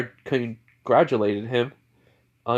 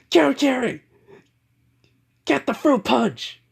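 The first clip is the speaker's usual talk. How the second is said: high-pitched, excited holler; celebratory call; 11 semitones higher, 3 dB louder than usual. Acted. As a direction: call out the party order with gusto. Villain calls out for party drinks.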